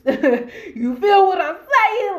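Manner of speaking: high, loud, slow, laughing it out. Real, not acted. To viewers after her threat, laughing.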